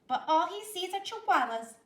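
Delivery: put-on high, loud, teasing girl; mock the brother; teasing sister. A narrator's reading. Sister mocking her brother, high teasing.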